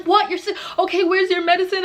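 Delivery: high-pitched startled questioning; alarmed friend. Retold or imitated. Friend asking where the medicine is, alarmed.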